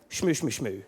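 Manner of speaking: low, soft mumbled shmoo chant; a quiet mock congregation murmur. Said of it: "anything that rhymes with".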